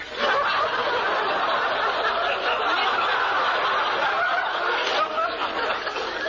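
big delighted belly laugh; hearty laugh. Acted. Gleeful laugh after a joke.

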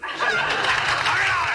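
high, slow drawn-out yell. Acted. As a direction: bellow it at the room. Judge barks at courtroom.

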